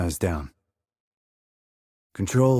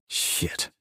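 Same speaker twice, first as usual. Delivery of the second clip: hushed curse, barely voiced; soft whispered swear; 5 dB quieter than usual. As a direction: swear under your breath so nobody hears. Sniper curses under his breath.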